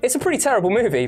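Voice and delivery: usual speaking voice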